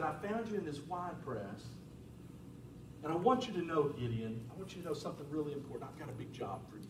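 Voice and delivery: soft and low, hushed and kind; quiet reassuring voice of God; gentle God voice. Acted. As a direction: speak tenderly, like calling someone out of hiding. God speaking gently to Gideon.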